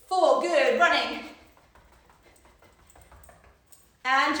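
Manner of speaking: loud, pumped-up workout cues; aerobics instructor. Acted. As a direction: hype the class through the final round.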